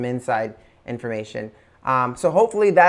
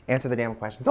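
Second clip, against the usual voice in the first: quiet; 4 semitones lower, 7 dB quieter, faster than usual. Retold or imitated. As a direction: answer the damn questions, said low and soft. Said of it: "My husband".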